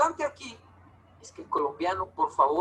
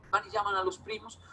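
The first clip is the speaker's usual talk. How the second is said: normal talking voice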